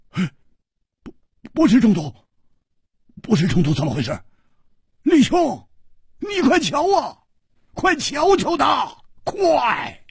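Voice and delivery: put-on high, fast, frantic young man's voice; panicked Hu Tiehua. Hu Tiehua begs Li to look at his sick friend, frantic. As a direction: shout for help in a rush of alarm.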